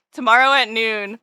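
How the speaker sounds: ordinary talk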